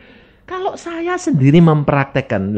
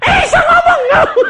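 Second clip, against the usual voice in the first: much higher, laughing, loud; amazed shout; 10 dB louder than usual. Retold or imitated. Husband hears wife speak, laughing amazed shout.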